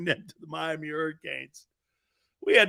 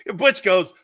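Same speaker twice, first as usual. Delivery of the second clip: ordinary delivery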